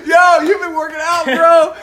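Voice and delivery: shouting through big laughter; laughing shout. Real, not acted. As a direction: yell it while cracking up. Reacting to arm wrestling win.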